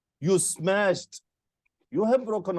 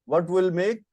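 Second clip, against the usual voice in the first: normal talking voice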